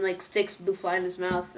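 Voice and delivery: ordinary talk, nothing marked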